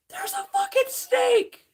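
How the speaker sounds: imitating screaming coworkers; panicked scream. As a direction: shriek it like the women running upstairs. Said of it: "they're screaming their fucking heads off".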